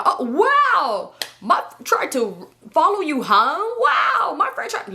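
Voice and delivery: high, put-on innocent voice; mock the friend's fake innocence; mocking fake surprise. Imitating his shady friend, high mock surprise.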